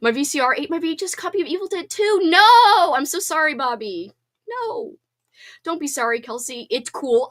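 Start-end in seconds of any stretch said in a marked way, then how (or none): 2.2-2.9 loud high "no!" of dismay; loud shocked groan of sympathy; dismayed cry. Real, not acted. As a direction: cry out in shock at bad news. Reacting to eaten tape.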